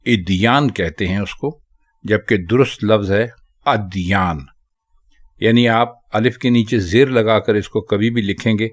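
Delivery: ordinary talk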